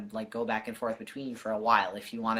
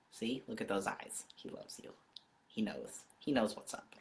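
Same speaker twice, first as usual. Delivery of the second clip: tender; 8 dB quieter than usual. Real, not acted. Tender quiet words to viewers.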